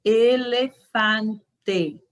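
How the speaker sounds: very slow "Elefante", every e closed; slow Spanish elefante. Shown as a demonstration. Teacher modeling elefante slowly. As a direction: spell it out slowly, closed e's. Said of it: "Pronuncie em suas casas".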